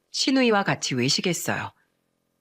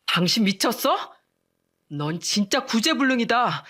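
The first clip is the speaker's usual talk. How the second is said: shouting husband; 3 semitones higher than usual. Acted. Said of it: "고함을 지르며 소리쳤어요".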